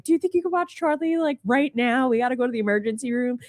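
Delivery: high, rushed phone plea; urgent request. Retold or imitated. Mother phoning aunt for babysitting, urgent and rushed. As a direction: hurry through an emergency favor.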